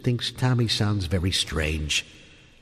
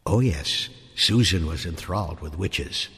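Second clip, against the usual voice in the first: ordinary delivery, nothing marked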